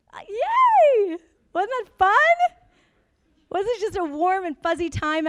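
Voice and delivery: high, loud mock celebration of the recession; sarcastic cheer. Speaker mocking the recession to the audience: high sarcastic cheer. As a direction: ironic squeal of fake joy, dragged out.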